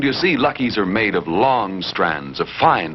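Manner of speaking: usual speaking voice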